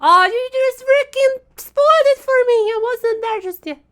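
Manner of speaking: high, loud indignant outburst; outraged surprise. Real, not acted. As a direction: protest the spoiler loudly. Friend spoiled the draw.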